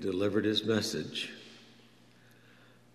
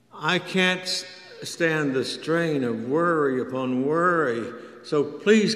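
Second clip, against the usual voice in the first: higher and louder, a desperate plea to God; pleading prayer; 6 semitones higher, 4 dB louder than usual. Retold or imitated. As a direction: beg the Lord for a friend's life.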